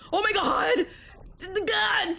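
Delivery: high, shocked exclamation; gasping oh my God at a wild play; shocked outburst. Real, not acted. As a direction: react to a near miss, stunned. Close miss in a game.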